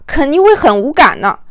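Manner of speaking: ordinary delivery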